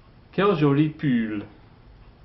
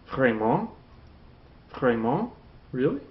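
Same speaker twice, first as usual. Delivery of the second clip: "Vraiment" and "Really" swept up as questions; rising intonation. Shown as a demonstration. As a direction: ask with pitch climbing at the end of "Vraiment" and "Really". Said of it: "make the intonation rise".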